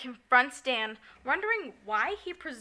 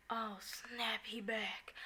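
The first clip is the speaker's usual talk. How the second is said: softer whispered panic, mother spotting ghost; whispered alarm; 12 dB quieter, slower than usual. Retold or imitated. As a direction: hush it like you just saw a ghost. Mother voiced seeing ghost.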